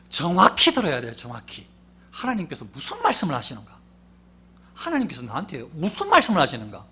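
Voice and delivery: ordinary talk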